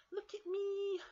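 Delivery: very high, loud cub shout; excited shout. Acted. Baby Bear plays in the leaves, high excited shout. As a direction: show off in a piercing child voice. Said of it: "he shouted".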